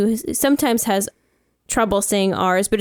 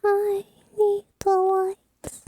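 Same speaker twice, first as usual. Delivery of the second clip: drawn-out whisper, pitched up; slow high whisper; 11 semitones higher, much slower than usual. Pretend.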